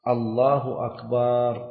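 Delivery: "الله أكبر" slowly, ending in a long "akbaar"; stretched ba. Shown as a demonstration. Teacher showing the wrong takbir, ba drawn out long. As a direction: hold the vowel after the b in "أكبر". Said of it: "أن لا يمد الباء".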